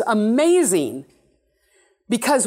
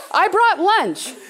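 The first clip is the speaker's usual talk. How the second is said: higher, slow, friendly offer; Jesus speaking; 10 semitones higher, 4 dB louder, slower than usual. Retold or imitated. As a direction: say it slowly, warmly offering a gift. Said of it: "And he'll say".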